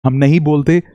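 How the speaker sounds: usual speaking voice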